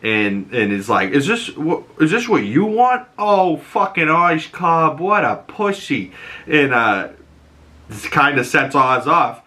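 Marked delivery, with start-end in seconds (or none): 1.1-6.1 higher, swooping taunt; mocking. [name] making fun of Oz, mocking. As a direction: taunt him with exaggerated pitch swings. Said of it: "[name] makes fun of him".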